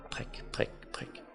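sagte prik uden klang i stemmen; hviskede priklyde. A narrator's reading. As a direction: hvisk prikkene med små ophold. Æggets prikkende lyde i fortællingen. (hviskende prikken)

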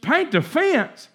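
can't believe the order; student balking at the new chore; incredulous echo. Retold or imitated.

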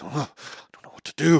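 high, strained panic; whining in a panic; panicked teen. Retold or imitated. Panicked teen self retold.

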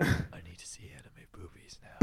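low whispered mock craving; horny teenager. Mocking own fifteen-year-old self watching anime, horny whisper. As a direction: whisper it like a desperate teen. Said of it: "horny fifteen-year-old who's like".

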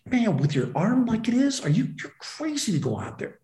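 imitating a friend's high-pitched disbelief; retold, animated scolding; incredulous objection. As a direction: protest as if he's out of his mind. Acts out friend's alarmed objection.

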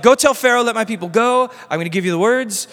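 loud, high, urgent order; God giving marching orders, loud and high; emphatic command. Acted. God commanding Moses, acted.

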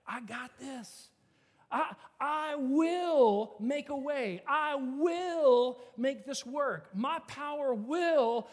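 slow, deliberate, weighty divine promise; voice of God. Acted. God reassures the doubting pastor, slow and weighty. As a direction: speak as God calming a worried pastor.

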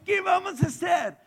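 loud, high cry of alarm; panicked crowd shout. Acted. Pastor voicing the people facing Jericho, panicked shout.

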